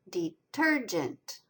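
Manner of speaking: "Detergent" slowly, second syllable strongest; over-enunciate "Detergent", leaning on ter; slow de-TER-gent for "Detergent"; stress on TER. Shown as a demonstration. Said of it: "Ter is accented".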